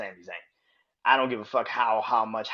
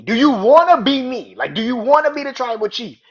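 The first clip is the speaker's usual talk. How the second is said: screaming high and much louder; furious yell; 12 semitones higher, 14 dB louder than usual. Retold or imitated. Roman, imitated, screaming at Sami in the ring. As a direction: yell it out in full rage. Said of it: "is like".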